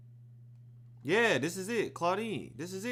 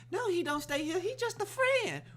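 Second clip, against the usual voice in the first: innocent denial; 13 semitones higher than usual. Retold or imitated. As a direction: play it innocent. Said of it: "mother answers social worker".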